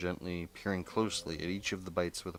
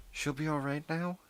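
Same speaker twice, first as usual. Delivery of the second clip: higher-pitched voice for an anxious mother; voiced worried mother; 9 semitones higher than usual. A narrator's reading. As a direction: ask it high, as the mother seeking reassurance. Mother asks worriedly in story.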